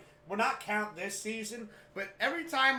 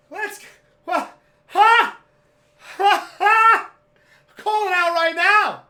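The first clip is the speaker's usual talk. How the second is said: high, full-throated victory yells; wild celebration; 13 semitones higher, 18 dB louder, slower than usual. Retold or imitated. Son celebrates winning the bet, wild high shouting. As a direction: whoop and holler in triumph.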